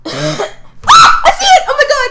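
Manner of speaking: high, panicked shrieking; real scream. Real, not acted. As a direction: scream it in sudden fright. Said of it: "I'm sorry for screaming".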